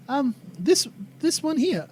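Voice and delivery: put-on accented woman pointing something out; Tracy. Tracy showing her book of names, high hesitant voice. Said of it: "Tracy kinda speaks up".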